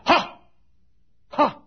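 loud, high hearty "Ha! Ha!"; imitating Jack Belby's big laugh; his laugh. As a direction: bark out the old man's laugh. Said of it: "you know how he used to laugh".

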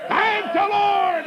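very high, loud, long-held cry; full-throated revival shout; shouted praise. Retold or imitated. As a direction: draw it out in a jubilant holler. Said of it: "I shouted yesterday".

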